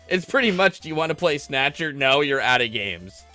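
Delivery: normal talking voice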